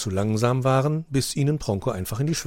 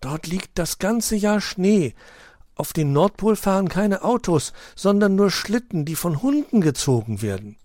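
eager pupil; 10 semitones higher, 4 dB louder than usual. A narrator's reading. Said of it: "hob ganz aufgeregt ihre Pfote".

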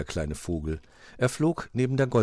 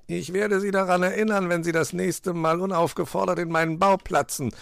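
high-pitched grumbling fox retort; growling fox; 11 semitones higher, 4 dB louder than usual. A narrator's reading. Fox warns magpie about his den, growling. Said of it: "knurrte Herr Fuchs".

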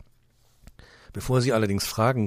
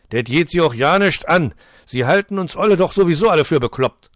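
loud, higher Berlin snap; gruff retort; 9 semitones higher, 13 dB louder than usual. Acted. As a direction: tell the boss off. Otto snaps at director. (schnoddrig-wütend)

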